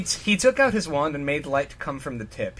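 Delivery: ordinary talk, nothing marked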